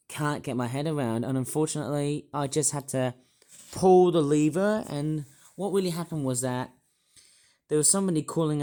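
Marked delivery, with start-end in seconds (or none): 3.7-4.8 slow, loud and higher; slow dramatic outburst; loud emphatic. Real, not acted. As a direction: lean hard on pull the lever. Dramatic climax of bus story.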